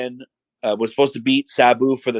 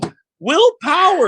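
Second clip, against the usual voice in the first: loud, high mock amazement; sarcastic; 5 dB louder than usual. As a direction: cry out in exaggerated fake awe. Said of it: "And he's super sarcastic".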